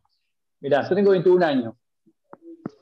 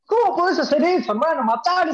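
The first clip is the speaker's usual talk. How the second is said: outraged outburst; 7 semitones higher than usual. Real, not acted. Angry fan yells about the player who faked an injury. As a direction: pitch jumps much higher in disbelief.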